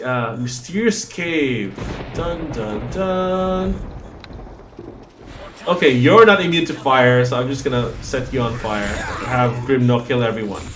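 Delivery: ordinary delivery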